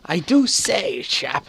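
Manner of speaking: mock an old-fashioned posh British gent; hammed-up posh British greeting; posh British accent. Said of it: "speak with a really posh accent".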